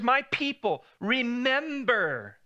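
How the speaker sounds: slow, high, drawn-out plea; God pleading. Retold or imitated. God pleading with his people to remember, slow and high.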